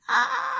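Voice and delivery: very high loud scream; witch's scream. A narrator's reading. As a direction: shriek as she falls. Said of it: "cried the witch".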